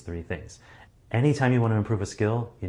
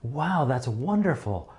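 higher, slow, delighted wow; overemphasized happiness; 5 semitones higher, slower than usual. Shown as a demonstration. Speaker demonstrating exaggerated happiness for someone, high and slow. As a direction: beam through the mask with joy. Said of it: "are more than happy when you express it".

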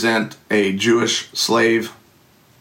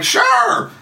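squeaky yes. Retold or imitated. Instant yes to trip.

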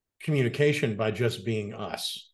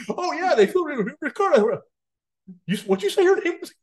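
high hammed-up double-take; 3 dB louder than usual. Retold double-take on name.

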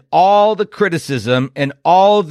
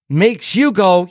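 usual speaking voice

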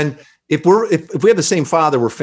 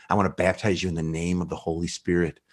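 soft baptismal blessing; 7 semitones lower, 8 dB quieter than usual. Retold or imitated. Final baptism in Spirit's name.

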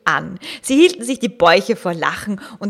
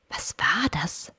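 hushed, startled question; erschrocken; 3 semitones lower, 7 dB quieter than usual. A narrator's reading. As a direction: ask softly, as if startled. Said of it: "fragte Marie erschrocken". (leise und erschrocken)

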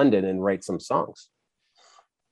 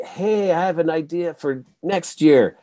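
imitating an eager attendee, pitched higher; mock-excited event-goer, voiced higher; eager fan; 7 semitones higher than usual. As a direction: play the keen fan pitching an idea. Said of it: "the people would be like".

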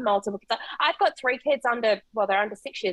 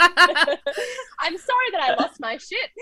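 laughing mock apology; 6 semitones higher, 5 dB louder, much slower than usual.

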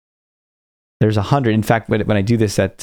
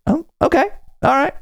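imitating hesitant stranger. Retold stranger hesitantly agreeing.

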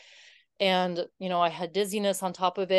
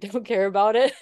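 laughing; 3 semitones higher, 7 dB louder than usual. Real, not acted. Laughs at her own point.

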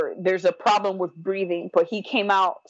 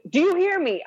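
retold anger; 10 semitones higher than usual. Mad mother demands husband listen, retold anger. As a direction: relive the angry demand to be heard. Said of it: "and I'm mad".

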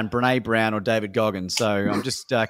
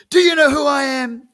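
loud proud shout; 9 dB louder than usual. Retold or imitated. Mentor shouting his self-belief.

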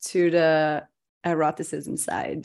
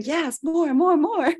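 excited cheering; 10 semitones higher, slower than usual. Retold or imitated. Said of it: "people are like".

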